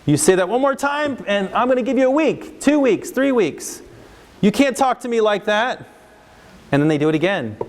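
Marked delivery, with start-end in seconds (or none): 0.0-3.8 high, heated threat piling on more weeks; angry parent threat. Acted. Parent threatening a defiant kid with more weeks, heated. 4.4-5.8 scolding parent. Acted. Parent scolding defiant kid.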